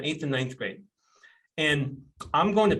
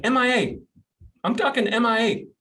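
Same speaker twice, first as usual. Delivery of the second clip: high, emphatic exasperation, retold; exasperated emphasis; 8 semitones higher, 4 dB louder than usual. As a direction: punch it out higher, reliving the disbelief. Retelling his exasperation at absent employee.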